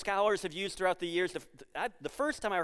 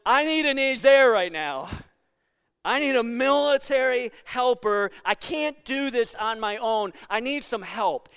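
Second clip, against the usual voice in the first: raised, louder urgent call for help; urgent plea; 3 semitones higher, 6 dB louder than usual. Acted. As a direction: plead urgently as someone needing backup. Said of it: "like our friends in, in Ukraine going".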